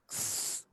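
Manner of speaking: "স" as a bare voiceless hiss. Shown as a demonstration. Teacher isolating the sound, স as a pure s hiss. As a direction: hold "স" as an unvoiced sss. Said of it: "সয়ের মতো হবে".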